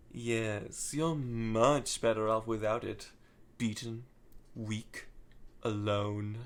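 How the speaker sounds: a quiet, menacing phantom's tease; sinister Starline. Retold or imitated. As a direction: purr it like a cool, taunting phantom. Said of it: "sinister tone".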